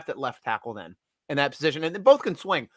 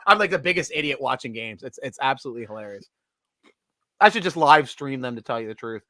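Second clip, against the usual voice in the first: ordinary delivery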